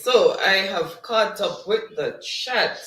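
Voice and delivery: ordinary talk, nothing marked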